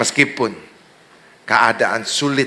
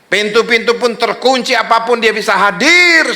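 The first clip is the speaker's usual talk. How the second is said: loud, raised preacher's cry; full-force preaching; 10 semitones higher, 8 dB louder than usual. Real, not acted. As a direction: build each phrase to a ringing final word.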